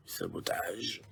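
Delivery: breathy dramatic whisper; whispered title. Acted. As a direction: whisper the word like a secret. Reads card title. (chuchoté)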